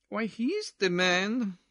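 slow, lilting belle; gossiping ballroom lady; dancing society lady. Acted. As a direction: draw it out as delicious gossip. Missus Pirouette gossips.

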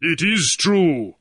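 lightly voiced slow boom of a huge guard; booming big man. Williami answering Grief, a mild slow boom. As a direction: let it roll out from a big chest. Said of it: "Williami boomed from his deep chest".

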